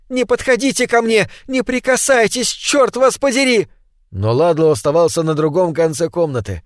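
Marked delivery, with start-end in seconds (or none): 0.1-3.7 frightened scream. Acted. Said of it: "и она закричала в темноту".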